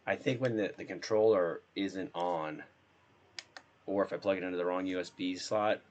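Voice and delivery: normal talking voice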